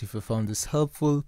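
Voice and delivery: ordinary talk, nothing marked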